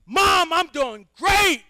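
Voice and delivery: high, loud calling out; shouting out window. Acted. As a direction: yell for mom from window. Recruit shouting to mother.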